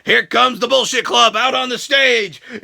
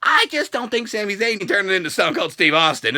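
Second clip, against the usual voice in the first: ordinary delivery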